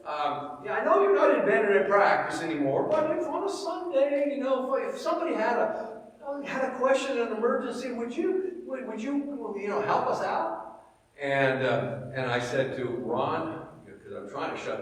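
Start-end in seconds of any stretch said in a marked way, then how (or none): 0.0-10.8 a mock Southern ask for a favour; imitating Southern Ron. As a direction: play the church member asking a favour in a Southern lilt. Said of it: "Ron's from the South".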